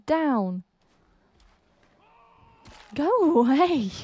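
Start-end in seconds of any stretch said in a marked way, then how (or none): 2.9-3.9 loud, high wail; panicky yell. Real, not acted. Player shooing off an attacking animal, panicky yell. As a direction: yell it drawn out at a pursuer.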